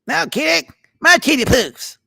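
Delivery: very high, loud cartoon kid voice; Eric Cartman impression. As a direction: squeal it like a bratty cartoon boy. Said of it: "what's the saying that Eric Cartman says".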